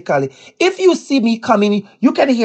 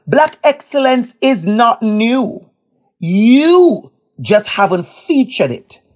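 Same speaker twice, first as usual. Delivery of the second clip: slow, weighty delivery with YOU drawn out; emphatic declaration; 2 semitones higher, slower than usual. Retold or imitated. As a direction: land each word deliberately, stretching YOU. Telling librarians off, point by point.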